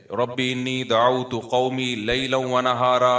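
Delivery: slow, sustained Arabic recitation; Quran recitation. Acted. Preacher quoting Noah's prayer to listeners, measured recitation. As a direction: recite the verse with drawn-out vowels.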